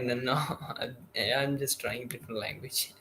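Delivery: quiet, mumbled words; real mumbling. Real, not acted. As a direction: mumble it softly, half to yourself. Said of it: "mumbling a little as you do it".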